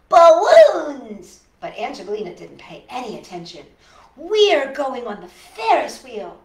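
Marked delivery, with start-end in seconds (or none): 0.1-1.4 loud, high joyful shout; excited cry. A narrator's reading. Child thrilled by balloons. 4.2-6.4 bossy. A narrator's reading. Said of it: "she said".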